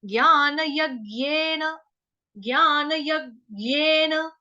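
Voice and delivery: यज्ञेन slowly, half ग pressed after य; slow yajnena. Shown as a demonstration. Teacher drills Sanskrit word यज्ञेन slowly for class. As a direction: draw out यज्ञेन with the half ग stressed. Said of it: "य के बाद ग का आघात".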